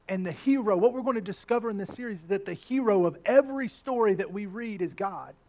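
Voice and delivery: usual speaking voice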